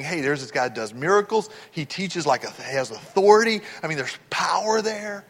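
soft, lightly acted wondering; hushed retold musing. Sick woman reasoning about the miracle worker, softly voiced musing. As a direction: voice her thought softly, as if confiding.

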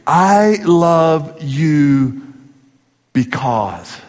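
husband tenderly complimenting his wife; tender demo. Shown as a demonstration. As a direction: say it slowly like a real compliment to your wife. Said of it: "Men, do it like this".

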